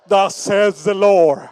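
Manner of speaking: slow, booming, solemn proclamation; speaking like Dale. Retold or imitated. Pastor imitates his father-in-law's grand preaching voice. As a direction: announce it like a grand old preacher. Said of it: "so I speak like Dale".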